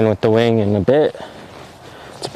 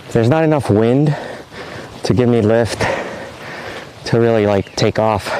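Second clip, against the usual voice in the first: usual speaking voice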